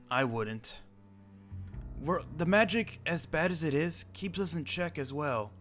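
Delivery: ordinary delivery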